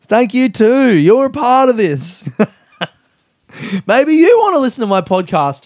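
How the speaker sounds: high, loud hammed-up holler; calling out. Podcaster roping in the fan's reluctant mate, calling out. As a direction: shout to include someone at a distance.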